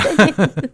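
a quick, bright laugh that bursts out; burst of laughter. Real, not acted. Host laughs at guest's joke about brewing wine, a sudden laugh.

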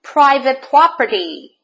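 loud warning to a trespasser; angry yell. A narrator's reading. Cactus to a visiting tortoise, yelling. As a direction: yell it to chase them off. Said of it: "yelled Hank".

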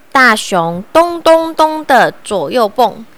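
usual speaking voice

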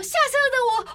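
high, loud mock outcry; hammed-up falling scream. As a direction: shriek it as if mid-fall. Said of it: "尖叫这样".

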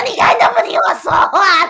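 loud, high tearful wail; retold crying protest; sobbing complaint. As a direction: cry it out as you storm off. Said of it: "我就开始爆哭".

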